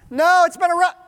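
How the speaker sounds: high, very loud answer; yelled reply. Acted. Fishermen answer the man on shore, yelling. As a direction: holler back across the water.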